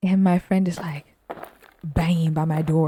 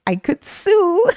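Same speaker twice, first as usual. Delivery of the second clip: mock shriek; 13 semitones higher, 6 dB louder, much slower than usual. Joking legal threat.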